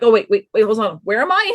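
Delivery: ordinary talk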